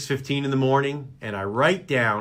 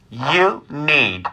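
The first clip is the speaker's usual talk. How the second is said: slow, measured mother impression; calmly insistent mother; 2 semitones lower than usual. Son imitates his worried mother, calmly insistent. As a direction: stretch each word out like a patient, insistent mother. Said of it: "my mother is very calmly telling me".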